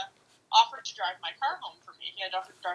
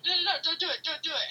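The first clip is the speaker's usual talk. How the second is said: higher, urgent retold begging; a mock-frantic plea not to run the light; panicked pleading; 9 semitones higher, faster than usual. Girlfriend begging boyfriend not to run the light, higher panicked plea.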